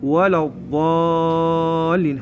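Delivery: ولا الضالين with a very long held "aa"; elongated model of الضالين; heavy dad. Shown as a demonstration. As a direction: recite slowly, stretching الضالين. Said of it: "هذا حرف الضاد".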